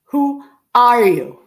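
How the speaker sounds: ordinary talk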